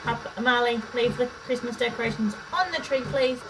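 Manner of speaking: high, firm parent warning. Real, not acted. Telling child off.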